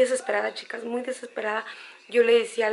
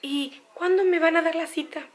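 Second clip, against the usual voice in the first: higher, pleading voice of an anxious mother; a hint of a fretting elderly mother, higher; worried mother; 7 semitones higher than usual. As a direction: lightly play the mom asking again and again. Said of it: "mi mamá me decía".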